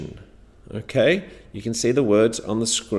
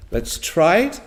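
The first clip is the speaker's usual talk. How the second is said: usual speaking voice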